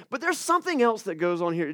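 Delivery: ordinary delivery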